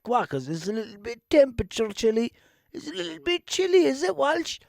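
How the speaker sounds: high, slow whiny talking hoodie; sulky hoodie voice. Pretend. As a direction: play a jilted hoodie sneering. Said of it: "the one that I have on me kind of going like".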